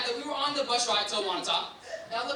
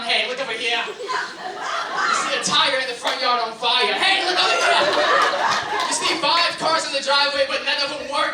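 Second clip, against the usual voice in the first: louder, excited tour guide; mock nature host; 6 dB louder, slower than usual. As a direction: safari host pointing things out. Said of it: "He was running around".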